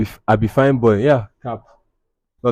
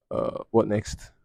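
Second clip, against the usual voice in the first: ordinary talk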